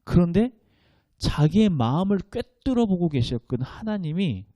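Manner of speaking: ordinary talk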